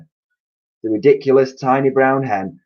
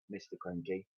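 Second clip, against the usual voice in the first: ordinary delivery, nothing marked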